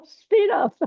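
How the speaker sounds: high, amused mock teacher voice; imitating the teacher. The teacher teasing a slow-walking student, high amused mock voice. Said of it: "he'd come up in the middle of your walking and go".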